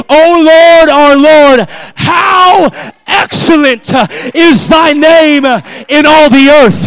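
high, loud, slow proclaiming cry; emphatic declaration. Shown as a demonstration. Preacher declaring the psalm to the church, emphatic cry. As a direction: declare it to anybody who would hear. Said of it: "put an emphatic statement".

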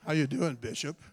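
low, smooth radio-DJ croon. Retold or imitated. Pastors fawning over the bishop in a low DJ voice. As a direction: croon it like a late-night DJ. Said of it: "they use that D J voice".

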